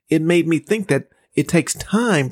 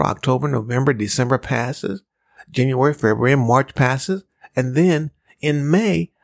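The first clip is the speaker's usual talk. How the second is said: normal talking voice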